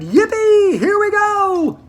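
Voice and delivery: high, loud whoop, slow at the end; shouted. A narrator's reading. Grandpa Joe as the lift plunges, whooping with joy. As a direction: yell it like riding a roller coaster. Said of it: "And Grandpa Joe shouted".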